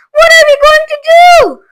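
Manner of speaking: high, loud despairing question; wailing. A narrator's reading. Mother wails to her husband in despair. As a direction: cry out helplessly. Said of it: "wailed Missus T V".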